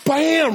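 loud, high "bam" of impact; crash sound. Retold or imitated. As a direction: punch out the bam like a body hitting floor. Imitates girl hitting the floor.

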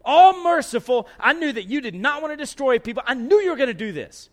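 loud, high, indignant outburst; I knew it, said hotly at God; mad at God. Acted. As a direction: prophet venting at God about mercy, angry. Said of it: "He is mad".

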